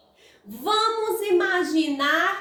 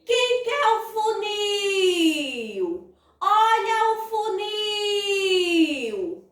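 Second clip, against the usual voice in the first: high sing-song hawker's call, very drawn out; market vendor's cry; 5 semitones higher, much slower than usual. Shown as a demonstration. Teacher offering the word to the public, sing-song vendor call. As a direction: sell it aloud, stretching "nil" in "funil". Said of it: "Vamos vender a palavra?".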